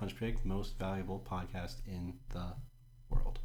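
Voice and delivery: every word of "Punch Pig, most valuable podcast in the world" stressed, slow; emphasizing every word. Shown as a demonstration. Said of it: "I'm glad you emphasized every word in that sentence".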